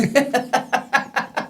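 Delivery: a real laugh at a crazy offer; disbelieving laugh; high burst of laughter. Real, not acted. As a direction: laugh out loud, high and bright.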